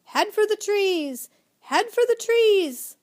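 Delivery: high, loud, slow calling shout; yelling loudly. A narrator's reading. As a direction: call across the sand to the boys. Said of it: "yelling to the boys as loudly as he could".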